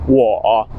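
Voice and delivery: "wo" dipping low in the throat; third tone wo. Shown as a demonstration. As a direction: drop "wo" down and leave it low. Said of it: "the word for, like, I".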